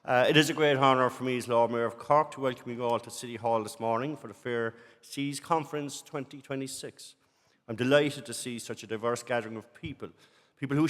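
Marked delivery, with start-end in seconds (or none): none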